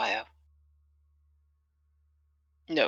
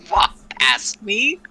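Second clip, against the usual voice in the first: loud, high, drawn-out indignant challenge; yelled challenge; 7 semitones higher, 10 dB louder, slower than usual. Acted. Slighted fighter challenges the one who walked by, loud and indignant. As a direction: call him out, slow and loud. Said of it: "she yells out".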